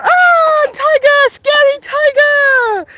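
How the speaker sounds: high, loud, slow pretend alarm; playful fake fear; mock fright. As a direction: act scared of the toddler's tiger.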